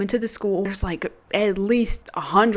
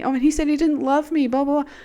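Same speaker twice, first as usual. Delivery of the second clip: mocking whine; 7 semitones higher, faster than usual. Mocking retold breakup whine.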